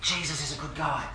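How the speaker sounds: soft, hushed mock whisper. Jackson passing a message to Anita in the game, mock whisper. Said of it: "Jackson whispers to Anita and says".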